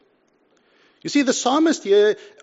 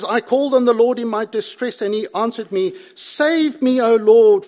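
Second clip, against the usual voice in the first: fervent cry to the Lord; 5 semitones higher, 7 dB louder than usual. Acted. Psalmist's plea quoted in sermon.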